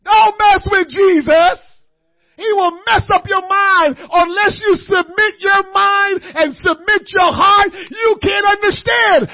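loud, high shouted preaching; rising shouted sermon; full-force preaching. Acted.

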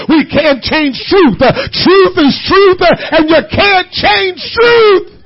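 loud, building sermon cadence; full-force preaching. Acted. Preacher driving the point home to the church, loud and rising. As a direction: preach it out hard to the congregation.

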